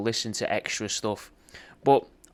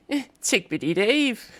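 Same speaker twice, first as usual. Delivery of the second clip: much higher, slow sing-song lilt; 10 semitones higher than usual. Shown as a demonstration. Showing how he matches the refrain, high and lilting. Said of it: "Or if I'd be like".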